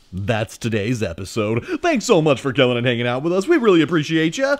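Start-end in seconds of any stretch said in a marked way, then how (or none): 1.8-4.6 louder and fast, upbeat sign-off; cheery outro. Real, not acted. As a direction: rush through thanks with big energy.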